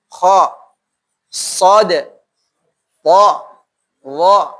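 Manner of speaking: ordinary delivery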